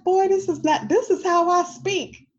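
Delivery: high, loud exasperated retort; indignant mom. Retold or imitated. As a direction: set your son straight, high and loud. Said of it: "And I'm like".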